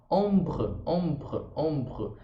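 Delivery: ombre said as one syllable, the R right after the B; French ombre. Shown as a demonstration. Teacher drilling ombre for her students, the final E silent. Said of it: "Para no meio desse B R".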